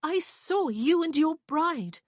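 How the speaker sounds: high, loud and slow, blurting out; blurted. A narrator's reading. Prue blurting to Rowan about his bride. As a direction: burst out with the hurt. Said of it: "she blurted".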